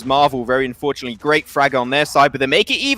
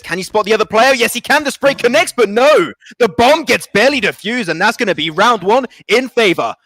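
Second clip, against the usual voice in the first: rising hype call, faster and higher; excited play-by-play; 7 semitones higher, 5 dB louder than usual. Real, not acted. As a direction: build the clutch moment to a peak. Clutch round call, casting.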